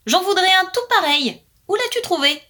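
high, eager little bird voice; eager blackbird. Acted. Blackbird begs the magpie for a ring, high and eager.

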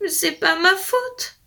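high, slow whimpering excuse; pitiful wolf. Acted. Old wolf pitifully excusing himself to the fairy. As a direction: plead it like a sorry old wolf. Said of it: "répondit le loup piteux".

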